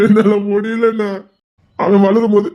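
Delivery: father crying about his son; sobbing despair. Acted. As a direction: wail it out in despair. Drunk father breaking down.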